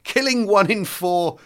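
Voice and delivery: normal talking voice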